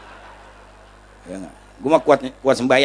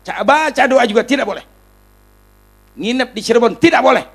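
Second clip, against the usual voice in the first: high, emphatic mock prohibition; hammed-up stern refusal; imitated official ban; 7 semitones higher, 4 dB louder than usual. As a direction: forbid it outright, raising the voice. Imitates official piling on bans.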